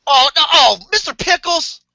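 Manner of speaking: high, appalled question; shocked disbelief; horrified surprise. Real, not acted. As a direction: recoil at the name. Shocked at show name.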